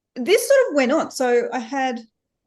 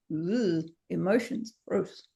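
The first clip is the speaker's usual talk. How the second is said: mock disgust; 4 semitones lower, 6 dB quieter, slower than usual. Mimicking everyone who brushes off emotions with mock disgust. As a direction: lower and softer, a groaning ugh of distaste. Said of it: "this thing that we all go".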